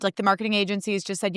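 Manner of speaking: usual speaking voice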